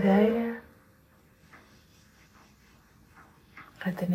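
soft, unhurried meditation voice with long pauses; guided meditation. Acted.

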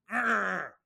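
loud, high mock howl of pain; mock anguished yell. Mocking Tarka's anguished cry.

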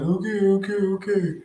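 high-pitched mock kid voice; put-on high voice, quick repeated agreement; imitated little kid. Kid reluctantly agreeing in retold scene.

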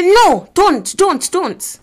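high, loud acted protest; urgent objection. Opposing voices silence the beggar, urgent objection. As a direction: cut in high, insisting they stop.

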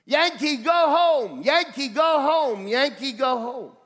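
loud, high mocking schoolyard chant; jeering chorus. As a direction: imitate a crowd of kids chanting a taunt. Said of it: "we heard a chorus of".

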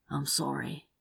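soft, subdued apology; quiet apology. A narrator's reading. Crowley apologises before burning the book, quiet.

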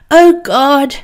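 moaning high and loud; a desperate moan to God. Acted. Broke man moaning up to God, pained. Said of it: "he moaned".

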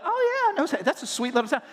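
high, quick delighted reply; brief gushing imitation; mock delighted listener. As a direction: act the impressed stranger. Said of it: "they'll be like".

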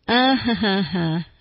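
fake, forced "ha ha ha"; Rich's courtesy laugh. Widow imitating her husband's response to mean remarks, fake courtesy laugh. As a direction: imitate a polite laugh, deliberately hollow. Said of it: "fake laugh".

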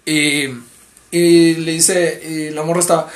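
usual speaking voice

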